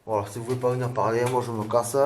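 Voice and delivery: normal talking voice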